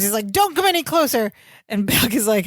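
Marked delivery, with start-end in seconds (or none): 0.3-1.3 high-pitched, hammed-up warning; mock scream. Host retelling Larry waking to see Balky, mock scream. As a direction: imitate a panicked scream at normal volume. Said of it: "and he screams".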